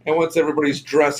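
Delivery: ordinary talk, nothing marked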